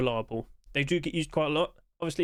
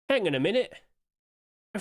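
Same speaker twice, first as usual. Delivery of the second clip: surprised; 5 semitones higher, faster than usual. Real, not acted. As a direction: catch yourself in disbelief. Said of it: "wait, hang on a minute".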